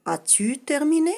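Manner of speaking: high, loud question; question tune. Shown as a demonstration. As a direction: ask it with a big rise. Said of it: "construite avec l'inversion". (question haute et forte)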